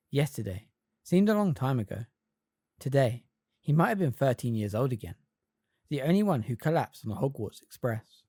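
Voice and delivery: usual speaking voice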